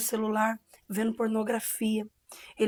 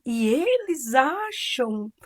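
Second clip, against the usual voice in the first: slow, drawn-out mockery with high swoops; imitated mocking demon; 5 semitones higher, 3 dB louder, much slower than usual. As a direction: stretch each word in exaggerated scorn. (imitando deboche arrastado)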